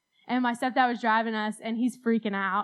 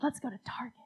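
whisper; 9 dB quieter, faster than usual. Retold or imitated. Mom's suggestion, quoted.

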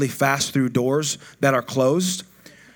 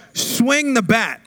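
"Swing the bat" called out higher and slow, every word drawn out; mild retold yell; 6 semitones higher, much slower than usual. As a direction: yell it from the sidelines, stretched long. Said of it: "I'd be screaming at him".

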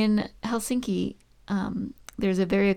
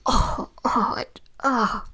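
wordless pained groaning; real disgust; 2 semitones higher, slower than usual. Real, not acted. Taster suffers through salty licorice, groaning.

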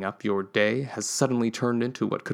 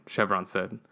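ordinary delivery, nothing marked

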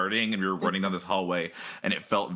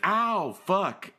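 mock pain; 7 semitones higher, much slower than usual. Lovers bump heads.

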